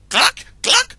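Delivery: very high, loud cluck; high panicked cluck; startled clucking. Acted. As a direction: squawk in alarm like a rooster. Rooster startled by fox.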